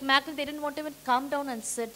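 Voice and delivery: usual speaking voice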